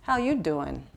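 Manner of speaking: low, soft New York swagger; New York accent. Pretend. Speaker jokes to the audience about feeling confident, New York accent. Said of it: "I did that 'cause I was from New York".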